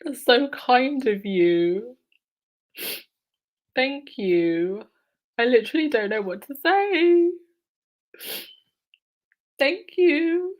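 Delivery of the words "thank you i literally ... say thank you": higher, choked-up thanks; tearful thanks. Real, not acted. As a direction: thank her through tears. Moved to tears by gift.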